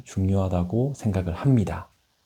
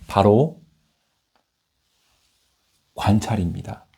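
ordinary talk, nothing marked

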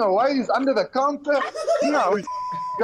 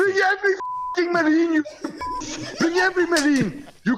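furious challenge; 5 semitones higher than usual. Real, not acted. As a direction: spit each word out in real rage. Furious father confronts prank caller.

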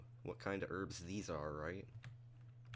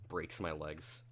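usual speaking voice

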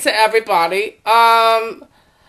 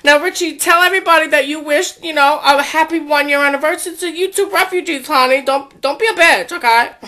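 normal talking voice

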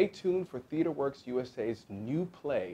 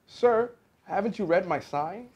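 higher, louder challenge to a customer; indignant calling out; 10 semitones higher, 8 dB louder than usual. A narrator's reading. Dentist to a fox who ignored his sign, calling out indignantly. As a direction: call out in raised, indignant pitch. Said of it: "Doctor DeSoto shouted".

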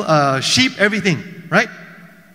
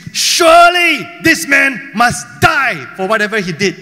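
mock furious shout, louder; angry king's outburst; 5 dB louder than usual. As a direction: bellow the verdict like an outraged king. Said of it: "He was angry".

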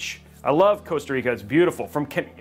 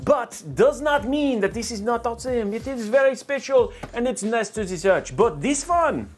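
mock European accent, product showcase; playful, thick foreign accent; put-on foreign accent; 2 semitones higher than usual. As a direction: present it in a hammed-up foreign accent. Comparing cases in a put-on foreign accent.

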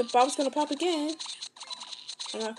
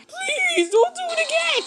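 high pleading voice; 3 dB louder than usual. Pretend. Character begging.

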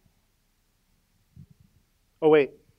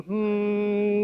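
sustained higher hum; loud group-leading hum; 5 semitones higher than usual. Shown as a demonstration. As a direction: hum one held note so the room joins in. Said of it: "Come on, hum".

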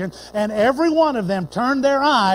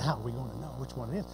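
hushed question; 9 semitones lower, 13 dB quieter, faster than usual. Retold or imitated. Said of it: "and they said".

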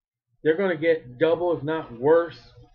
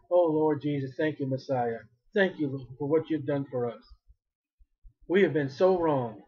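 softer, lower grateful prayer; humble thanks; 4 semitones lower, 5 dB quieter than usual. Acted. Preacher voicing restored Israel, soft and thankful prayer. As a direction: hush down and thank him humbly. Future Israel worships Jesus.

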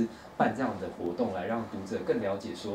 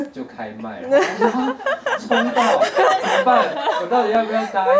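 much higher and louder, laughing panic; excited; 12 semitones higher, 11 dB louder than usual. Real, not acted. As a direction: spill it out in laughing alarm. Recounts drag show ticket panic.

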